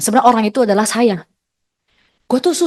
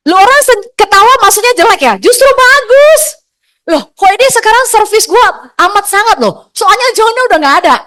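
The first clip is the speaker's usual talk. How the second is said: excited joking; 9 semitones higher, 5 dB louder than usual. Real, not acted. Joking about husband, audience laughing.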